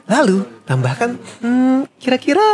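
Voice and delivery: very high put-on feminine voice; campy falsetto female character. Shown as a demonstration. Demonstrating the cooking YouTuber he voiced, campy falsetto. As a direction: play a cooking lady in airy falsetto. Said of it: "suaranya kok rada kayak banci".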